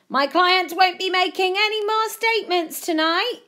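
high, loud yelled announcement; yelling. A narrator's reading. Friend fending off reporters for his client, yelling high. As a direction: yell it over the press pack. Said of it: "A J yelled".

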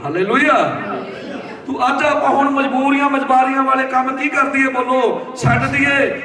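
high, driving preacher's call; full-force preaching. Real, not acted. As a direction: exhort the congregation with rising fervour. (ਪੂਰੇ ਜੋਸ਼ ਨਾਲ ਉੱਚੀ ਸੁਰ ਵਿੱਚ ਪ੍ਰਚਾਰ)

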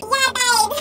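high, loud Essex twang; Essex accent. Retold or imitated. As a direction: slip into a friend's Essex accent. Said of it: "she has the most Essex accent".

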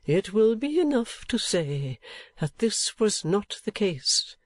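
put-on old gentleman, sober recollection. Old gentleman telling of her appeal, sober. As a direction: recount her distresses in measured phrases.

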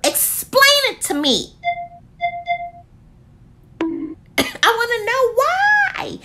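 high, slow, pleading demand; mock-indignant plea to the brand; exasperated demand. Real, not acted. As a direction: beg for an explanation, drawn out.

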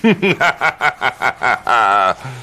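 smug, scheming chuckle; villain laugh. Acted. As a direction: savor the cruel joke. Advisor gloats over Chauncey's fate.

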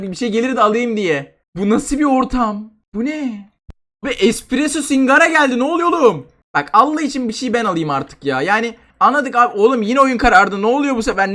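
ordinary talk, nothing marked

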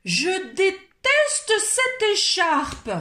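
slow, high furious yell; a child howling her hatred of the scarf; screaming in a tantrum. Acted. Odile shrieking at her parents about the scarf, furious. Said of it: "hurlait-elle".

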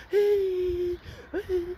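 high-pitched mock crying; put-on high whimper; mock wail. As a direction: wail it out in a high fake cry. Mock wailing at critics.

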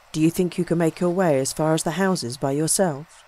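plain reading voice

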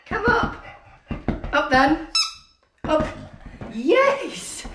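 very high coaxing call; coaxing dog call. Real, not acted.

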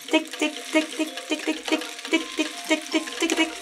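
high, small ticking; tiny staccato high-pitched pecks; pecking sound imitation. A narrator's reading. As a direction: tick like beaks tapping on wood. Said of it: "klonk het".

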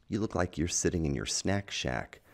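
plain reading voice

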